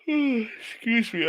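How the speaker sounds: "Excuse me" stretched through a yawn, slow and high. Real, not acted. Radio host yawning on air, apologizing to listeners. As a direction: yawn out the words with a sigh. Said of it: "I apologize for the yawning".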